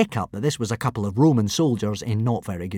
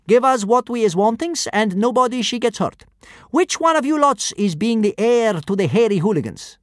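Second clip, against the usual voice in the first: high, loud brute in broken Norse; kidnapper voice; 10 semitones higher, 4 dB louder than usual. A narrator's reading. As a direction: boom it out like a thug. First kidnapper's broken speech.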